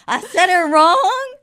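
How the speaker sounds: high, loud and slow, a worried question; a sudden 'wait, did I mess up?'; mock alarm; alarmed surprise. Worried reply in anecdote.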